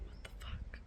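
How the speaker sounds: tearful whispered disbelief; stunned whisper. Real, not acted. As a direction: breathe it out in shock. Overwhelmed by book, crying.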